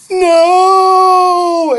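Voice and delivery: high loud falling scream. A narrator's reading. Boy falling off the eyelid, screaming. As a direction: scream as you tumble down. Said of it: "Andrew screamed".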